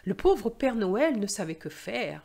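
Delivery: gentle storyteller reading over music; French story read aloud, clear and measured; story narration. Acted. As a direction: read a Christmas tale to learners.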